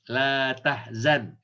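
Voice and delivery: "La tahzan" stretched very slowly; slow emphasis. Shown as a demonstration. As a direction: drill "La tahzan" syllable by syllable. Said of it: "Apa kata Quran". ("La tahzan" dibaca sangat pelan)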